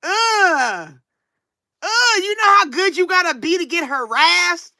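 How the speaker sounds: high, smug mock boast; high sarcastic brag; mock bragging. Mock bragging about harassment.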